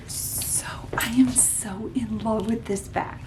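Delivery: soft adoring whisper. Real, not acted. Whispering her love to the bag. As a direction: breathe it to the bag.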